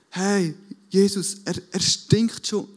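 hammed-up dismayed protest; imitating Martha's protest. Acts out Martha warning Jesus.

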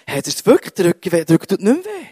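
imitating an astonished healed woman, pitch jumping up at the end; amazed disbelief.

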